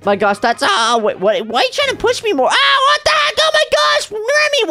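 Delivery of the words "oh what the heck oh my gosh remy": very high indignant squeal; hammed-up, high-pitched outrage; shocked protest. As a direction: squeal at the one who pushed you. Pushed by brother.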